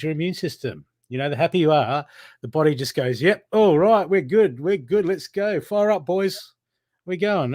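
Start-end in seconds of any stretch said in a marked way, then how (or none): 3.2-7.5 high, loud pep-talk cheer, drawn out in places; rally the troops with a sing-out lift; fired-up rallying. Acted. The body cheering itself on once you are happy, fired-up rallying.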